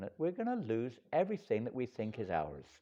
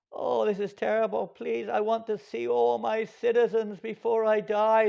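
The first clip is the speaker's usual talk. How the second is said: pleading dying king; 12 semitones higher, 8 dB louder than usual. Acted. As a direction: beg to see them before the end.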